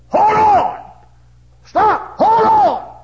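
loud urgent shout. Retold or imitated. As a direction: yell it to hold on. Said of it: "the fellow that told me said".

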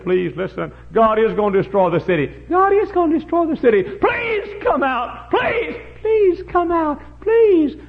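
high, loud begging that climbs to a wail; desperate pleading. Acted. Father begging his children to leave the city, desperate plea. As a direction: plead harder with each cry, drawing out the words.

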